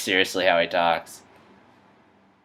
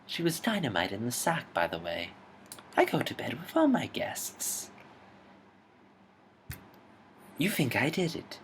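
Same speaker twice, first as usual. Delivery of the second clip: hotel concierge; 5 semitones higher, 7 dB quieter than usual. Acted. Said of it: "The Grand Budapest Hotel".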